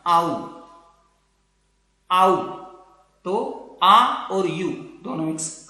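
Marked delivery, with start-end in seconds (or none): 0.1-2.6 "आउ" glides from long a to u, the second louder; diphthong "au". Shown as a demonstration. Teacher demonstrating the new diphthong to students: drawn "आउ". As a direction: model "आउ" twice, sliding a into u. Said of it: "आ के साथ ये sound".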